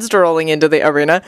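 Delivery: ordinary delivery, nothing marked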